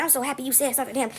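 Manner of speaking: quick, soft aside from a bystander; hushed confiding. Retold or imitated.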